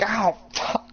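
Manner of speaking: high mock yelp of pain. Friend mocks him getting bitten by a turtle, high pained yelp. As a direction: yelp like a turtle just bit you. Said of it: "you're like".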